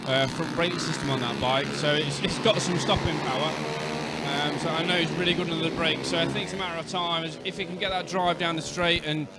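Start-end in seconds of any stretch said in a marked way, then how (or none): none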